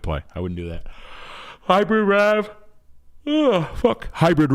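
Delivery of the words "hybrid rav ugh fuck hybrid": exasperated groan. Real, not acted. Groaning at car choice.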